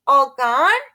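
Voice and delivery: put-on high, loud toddler question; little child asking. A narrator's reading. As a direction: ask like a small child. Toddler Fudge asks.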